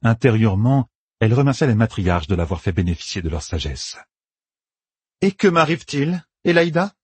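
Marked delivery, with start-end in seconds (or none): 5.2-6.9 higher-pitched, mock-innocent question; ironic. A narrator's reading. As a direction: toss the question out as a taunt. (question lancée avec ironie)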